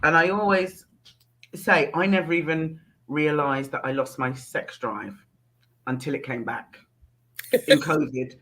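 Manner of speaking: ordinary delivery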